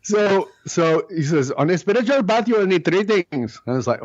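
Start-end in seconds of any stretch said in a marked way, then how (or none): 1.5-3.6 put-on higher Indian accent, deliberate; Indian-accented spiritual master. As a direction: play the Indian teacher. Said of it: "That's why I do the accent".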